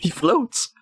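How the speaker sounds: high-pitched delighted disbelief; real giggly astonishment; amazed. Real, not acted. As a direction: exclaim it high, amid giggles. Repeats amazement at floating character.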